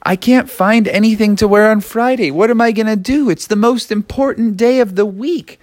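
put-on high-pitched kid pleading to mom; worried kid. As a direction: voice a child in a mild panic. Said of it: "he was like".

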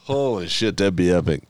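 normal talking voice

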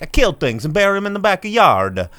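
high, loud mock Italian accent; hammed-up Italian papa boasting; Robert De Niro. Host parodying the trailer's Italian father, mock Italian accent. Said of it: "Robert De Niro's like".

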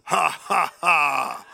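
booming loud slow laugh, ha by ha; mighty triumphant heavenly laugh; God's laugh. Retold or imitated. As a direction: laugh like the Lord on his throne. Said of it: "And he sounds like this".

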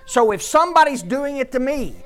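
rising fervent sermon cadence; building preaching. Acted. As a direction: preach it up to the peak, line by line.

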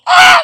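a sudden very high, very loud scream; ecstatic scream. Retold or imitated. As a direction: let out a wild joyful shriek. Sister bursts off in ecstasy.

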